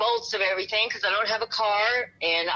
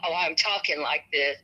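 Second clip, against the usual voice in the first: normal talking voice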